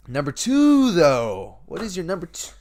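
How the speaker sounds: very high, loud drawn-out call; soaring, stretched-out mock fanfare; playful hype. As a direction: belt it out like a teasing countdown host. Playfully hyping the next list item.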